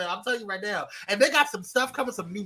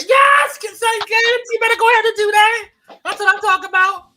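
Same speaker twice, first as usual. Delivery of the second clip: excited shout; 12 semitones higher, 12 dB louder than usual. Real, not acted. Celebrating reaching one K.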